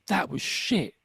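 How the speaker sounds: a terse self-critical snap, voiced apart; the old inner critic putting you down. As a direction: mutter it like your harsh inner voice. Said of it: "almost what my voice is".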